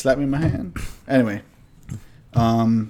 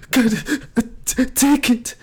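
much higher, slow mock wail through tears; 12 semitones higher, slower than usual. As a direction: imitate a crying kid choking out the word. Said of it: "I'm, like, wailing at this point".

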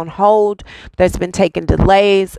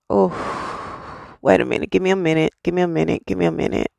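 emotion rising, voice sinking softer to almost nothing; overwhelmed, fading quiet; 6 dB quieter than usual. Real, not acted. Reader overwhelmed mid-message, asking for a moment, softly. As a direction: pull back as feelings overtake you, softer each time. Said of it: "I have chills all over my".

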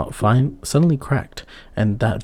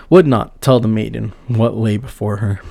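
ordinary delivery

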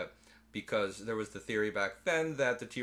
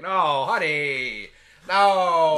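slow, much louder drawn-out no honey; mock scolding; 6 semitones higher, 13 dB louder, much slower than usual. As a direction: drag it out like refusing a stray dog.

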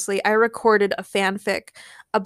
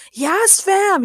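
high, elated exclamation; overjoyed shout; 9 semitones higher, 4 dB louder than usual. A narrator's reading. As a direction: cheer in an excited character voice. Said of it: "he shouted, overjoyed".

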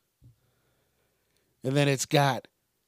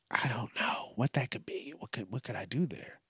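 hushed whisper to himself; 3 semitones lower, 13 dB quieter than usual. Real, not acted. Podcaster muttering ideas to himself in a whisper. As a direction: breathe it under your breath.

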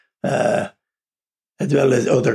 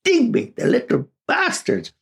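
high exasperated aside. Retold or imitated. Grown man recalling cousins' taunts, high and exasperated. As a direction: squeak out the grievance.

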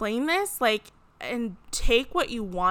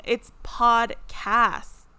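"It's podcast" drawn out very slowly and louder; syllables stressed; 6 dB louder, much slower than usual. Shown as a demonstration. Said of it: "clap it out for those of you in the back".

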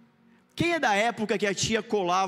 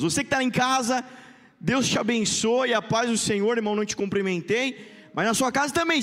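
normal talking voice

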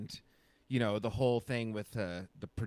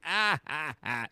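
mock laugh in separate bursts; Lucy; 4 semitones higher than usual. Retelling Lucy reading the fake contract, imitated laughing. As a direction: fake a raised, spaced-out "ah, ah, ah" laugh.

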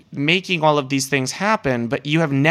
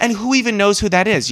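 exasperated rant; 6 semitones higher than usual. Retold or imitated. Mock reply continues.

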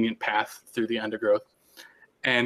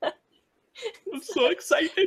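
very slow, high and laughing; delighted giggle stretched out; laughing excitedly; much slower than usual. Real, not acted. Player thrilled by the birds, laughing high.

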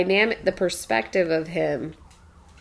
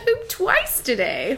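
slow, higher, giggling through "Pooped twice today"; laughing repeat; 6 semitones higher, slower than usual. Real, not acted. Mom repeating her son's words, cracking up laughing. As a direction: savor the line while laughing.

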